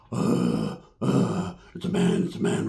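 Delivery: very low, creaky croak; mock dumbfounded. Harley Wood standing there stunned, low croaking imitation. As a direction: groan it out in a dropped voice.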